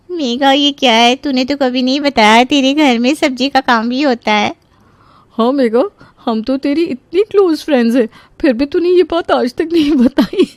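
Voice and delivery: high, taunting friend; teasing college friends. Acted. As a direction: tease her with put-on surprise.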